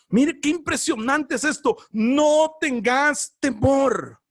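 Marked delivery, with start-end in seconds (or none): 1.9-4.2 slow proclaiming shout. Real, not acted. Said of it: "preacher proclaims".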